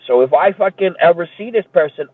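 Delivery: ordinary talk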